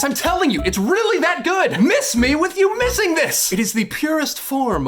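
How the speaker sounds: a burst of high, urgent enthusiasm; excited insistence. Real, not acted. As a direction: push it up high to convince the viewer. Insisting hard the show is great.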